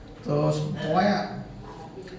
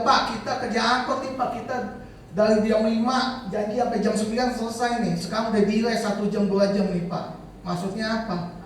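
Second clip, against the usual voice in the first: high-pitched mock protest from an angry driver; retold angry complaint; 7 semitones higher than usual. Unpaid angkot driver demanding payment from the organiser, retold angry complaint. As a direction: retell an indignant complaint, pitched up. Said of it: "marah-marah. Dia bilang".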